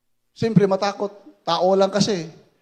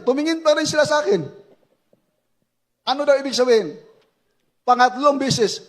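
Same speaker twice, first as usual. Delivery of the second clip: ordinary delivery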